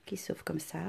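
a soft whisper to herself. Shown as a demonstration. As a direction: drop to a whisper. Repeats phrase, then whispers it. (chuchoté tout bas)

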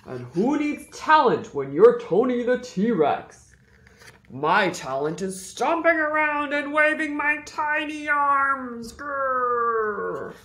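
proud dinosaur with a growl; boastful T-Rex. Acted. Tony the T-Rex character voice.

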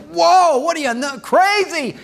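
loud, high mock outburst of disbelief; shocked disbelief. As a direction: act out a scandalized crowd recoiling. Voices shocked crowd answering Jesus.

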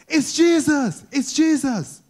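high excited shout of recognition; crying out. Acted. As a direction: call out like you just spotted him in the crowd. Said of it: "somebody cries out".

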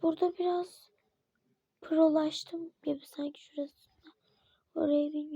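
ordinary talk

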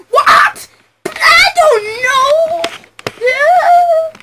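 very high, strained panicked cry; frantic high-pitched lawyer; panicked squeak. Acted.

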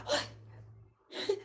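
a voiceless terrified gasp; breathless gasp of fright. Shown as a demonstration. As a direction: gasp as it lunges. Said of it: "Qué horror". (jadeo sin voz de terror)

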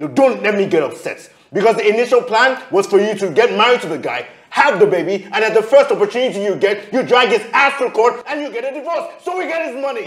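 loud, high, heated rant climbing in pitch; angry tirade. Acted. Man reminding his lover of their plan, angry tirade. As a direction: spell out the plan in exasperation. Quarrel, angrily spelling out plan.

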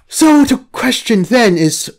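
ordinary delivery

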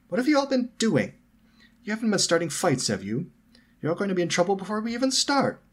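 high, loud, fussy telling-off; scolding. A narrator's reading. Hermione catches friends after a fight, scolding. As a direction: scold them in a high, urgent voice.

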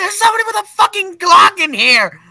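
very high, loud scream; hammed-up shriek of alarm; panicked screaming warning. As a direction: scream at them to flee. Screaming warning about a gun.

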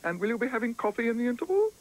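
high, quick, polite usherette; lightly voiced theatre attendant offering coffee; an attendant asks Paddington about coffee, politely.

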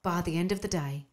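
ordinary delivery, nothing marked

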